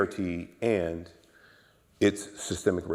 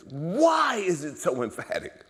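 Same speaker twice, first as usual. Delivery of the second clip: high, drawn-out plea for an answer; urgent questioning; 11 semitones higher, 7 dB louder, slower than usual. Retold or imitated. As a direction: retell puzzling aloud, stretching the question slowly. Quotes his own urgent questioning.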